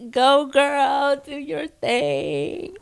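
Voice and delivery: high, drawn-out hype; playful over-the-top encouragement; mock cheering on. Imagined cheering on a daughter.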